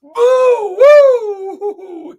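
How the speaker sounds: piercing high owl hoot; hammed-up shrieking owl; frightened Owl. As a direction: hoot it loud in alarm. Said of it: "he said, as Owl".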